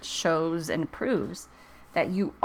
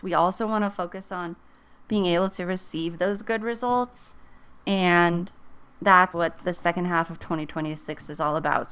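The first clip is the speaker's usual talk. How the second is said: ordinary talk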